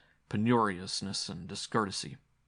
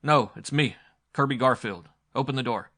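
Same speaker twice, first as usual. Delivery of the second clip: raised, louder call; 5 semitones higher, 9 dB louder than usual. A narrator's reading. Visitor announcing himself at a cabin door, calling out. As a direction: call through a closed door.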